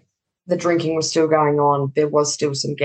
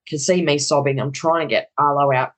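normal talking voice

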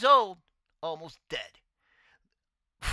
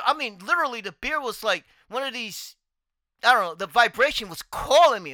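ordinary talk, nothing marked

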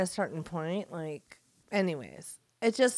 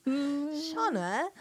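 higher-pitched, badly faked English; attempted English accent; 7 semitones higher than usual. Friend playing Oscar Wilde calling Shauna, failed English accent. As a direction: try an English accent and miss. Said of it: "I can't do an English accent".

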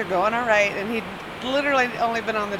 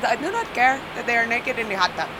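put-on Indian accent; 3 semitones higher than usual. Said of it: "that's how Bill tells the story".

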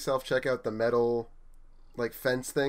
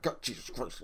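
exasperated under the breath; 6 dB quieter than usual. Retold or imitated. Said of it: "I'm like".

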